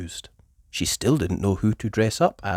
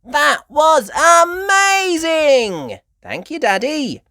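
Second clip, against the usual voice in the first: high, loud, slow exclamation; thrilled shout; 9 dB louder, slower than usual. A narrator's reading. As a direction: burst out in delight. Peppa thrilled.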